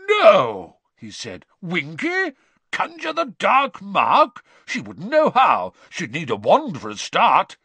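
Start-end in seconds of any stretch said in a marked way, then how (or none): 0.1-0.8 loud, high, drawn-out shock; disbelief. A narrator's reading. Bagman realising who is accused, disbelieving. 1.6-7.6 high, swooping, scoffing questions; incredulous. A narrator's reading. Bagman defending Winky to the wizards, incredulous. Bagman doubts elf could do it.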